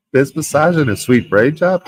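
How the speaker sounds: baffled, can't believe what the chat just wrote; incredulous reread. Real, not acted. Streamer rereading a rude chat comment, incredulous.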